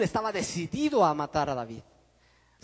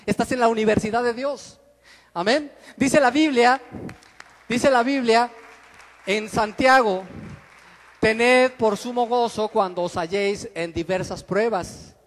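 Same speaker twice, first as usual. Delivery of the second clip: loud, rising pulpit cry; fiery preacher reading scripture; full-force preaching; 6 dB louder than usual. Acted.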